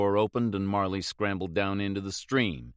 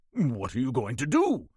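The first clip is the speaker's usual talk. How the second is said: crack in voice; 2 semitones higher than usual. Acted. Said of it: "there was a crack in his voice".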